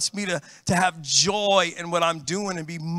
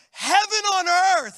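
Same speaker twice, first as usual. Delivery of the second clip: gushing excitement; 10 semitones higher, 3 dB louder, slower than usual. Real, not acted. Raving about express lanes.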